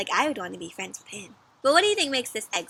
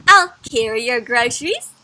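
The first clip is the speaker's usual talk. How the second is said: bright, loud storybook egg voice; cheerful egg character; 10 dB louder than usual. Acted. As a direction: play the proud little egg.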